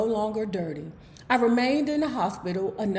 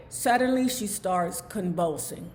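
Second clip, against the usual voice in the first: normal talking voice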